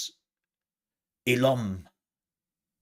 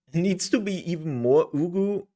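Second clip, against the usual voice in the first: higher, louder put-on cuteness; exaggerated uwu voice; 8 semitones higher, 6 dB louder than usual. Streamer straining for more ugu, high put-on cute voice. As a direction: push the cutesy voice up and out.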